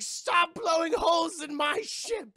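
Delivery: high, loud, drawn-out protest; hammed-up captain howling for them to stop; indignant shout. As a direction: yell it in exaggerated outrage. Jack acted protesting the attack.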